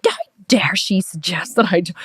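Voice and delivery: hushed, breath-heavy mock outrage; indignant. Client offended by coach's suggestion, indignant. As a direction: half-whisper it in offended disbelief. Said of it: "I was really indignant".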